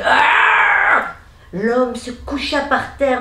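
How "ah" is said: high, loud monster scream; horrible cry. A narrator's reading. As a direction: let out a horrible beastly cry. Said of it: "il entendit un cri horrible".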